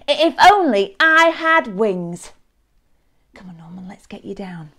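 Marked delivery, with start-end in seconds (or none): 0.1-2.3 high, loud, eager longing; excited wishing. Acted. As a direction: exclaim a new wish, carried away. Norman wishes for wings, thrilled.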